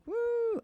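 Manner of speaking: high mock cheer from a clapping machine; a high hooting woo like a canned crowd; voicing a clapping machine's woo sound; imitated machine woo. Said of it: "the one that goes like".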